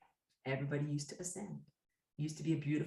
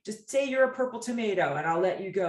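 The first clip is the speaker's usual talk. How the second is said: a hint of a badgering interrogator, louder; pressing captor; 3 semitones higher, 8 dB louder than usual. Captor pushes a prisoner to agree to anything, lightly acted badgering. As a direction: press them loudly for a false confession.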